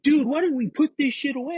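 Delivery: high, whiny imitation of the panicking friend; mocking whiny friend. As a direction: squeak it out like a scared buddy blaming you. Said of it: "that's what he was saying to me".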